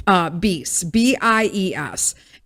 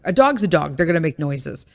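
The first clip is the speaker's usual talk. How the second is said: normal talking voice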